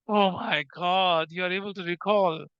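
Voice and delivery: long, slow, low drawl of feigned wonder; mock amazement. Teacher teasing students who finally recall the equation, mock amazement. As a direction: stretch every word out in mock awe.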